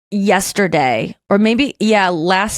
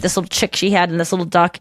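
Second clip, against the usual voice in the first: ordinary talk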